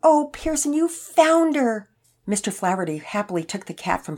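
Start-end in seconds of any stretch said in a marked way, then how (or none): 0.0-1.9 high, loud relief, stretched out slowly; overjoyed. A narrator's reading. Elderly man getting his lost cat back, overjoyed. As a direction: exclaim with delighted relief.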